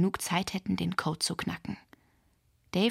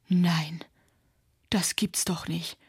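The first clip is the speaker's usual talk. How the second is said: slow, horrified disbelief; slower than usual. A narrator's reading. Nota finds the rehearsal room robbed, horrified. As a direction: let the shock drag the words out. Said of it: "rief Nota entsetzt".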